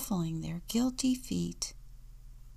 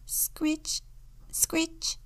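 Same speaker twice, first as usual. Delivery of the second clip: high, short scritch of scratching; high-pitched onomatopoeic scratch; scratching sound effect; 10 semitones higher, 4 dB quieter than usual. A narrator's reading. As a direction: voice a little scratching noise at the door, high. Narrator imitates scratching at door.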